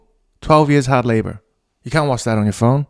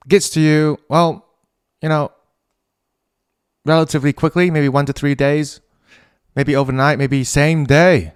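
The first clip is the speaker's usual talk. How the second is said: ad read; 3 semitones higher, 3 dB louder than usual. Acted. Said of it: "I'm halfway through an ad".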